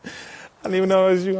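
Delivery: excited exclaim. Real, not acted. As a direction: call it out to him, thrilled.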